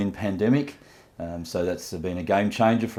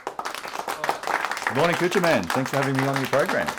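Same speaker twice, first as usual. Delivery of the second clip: robot voice; 6 semitones higher than usual. Acted. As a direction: talk like a machine. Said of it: "Is he speaking like a robot?".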